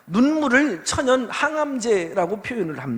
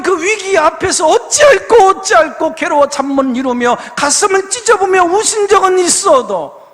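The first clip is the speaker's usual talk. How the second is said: loud, urgent preacher's appeal; preaching at full force; 7 semitones higher, 9 dB louder than usual. Acted. Pastor pleading with his congregation, loud preaching. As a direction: press the congregation hard, voice raised and ringing.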